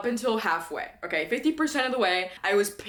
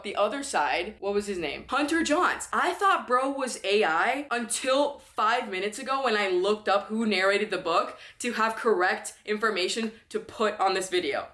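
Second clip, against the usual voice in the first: normal talking voice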